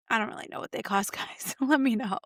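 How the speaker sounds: usual speaking voice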